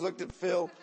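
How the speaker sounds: usual speaking voice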